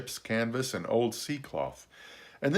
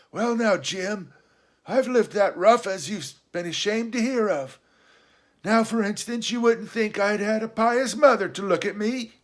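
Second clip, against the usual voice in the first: high castaway voice, confiding; chatty old castaway, pitched well up; Ben Gunn; 11 semitones higher, 4 dB louder than usual. Acted. Castaway telling the boy of his pious mother, high and confiding.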